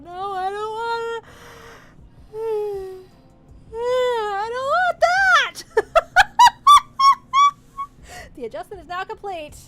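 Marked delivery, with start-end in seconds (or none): 0.0-5.6 slow, drawn-out yawn through words. Acted. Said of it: "chain of yawning". 5.7-8.3 high, loud burst of laughter. Acted. Laughing at the yawning.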